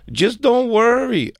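high, slow, sing-song don't worry; mimicking her. Jay mimicking the woman's reassurance, high and slow. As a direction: drag it out blandly. Imitating woman's stock reply again.

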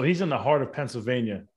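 ordinary delivery

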